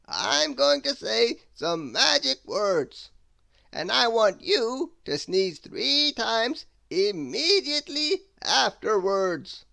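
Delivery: high, loud, slow theatrical wizard. Acted. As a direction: savour every grand word like an eccentric wizard. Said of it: "said the wizard".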